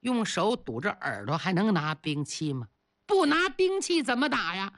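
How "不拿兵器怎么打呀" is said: frightened cry to flee; panicked cry. Acted. Chiyou's soldiers crying out to flee the drums, panicked. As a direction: yell in panic to run.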